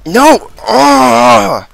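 high loud cry of dismay; frustrated shout. Real, not acted. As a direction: yell out in frustration. Dies in game.

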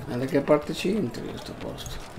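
ordinary talk

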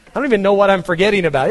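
ordinary talk

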